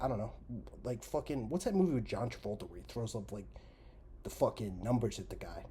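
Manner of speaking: ordinary talk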